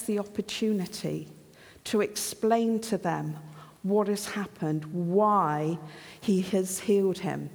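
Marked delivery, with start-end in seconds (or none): none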